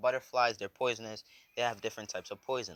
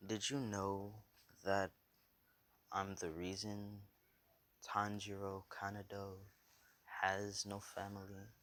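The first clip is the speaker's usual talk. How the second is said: soft, halting menace; hushed, drawn-out confession; quiet villain reveal; 7 dB quieter than usual. Acted. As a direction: reveal it softly, pausing after each phrase. Villain reveals his crime.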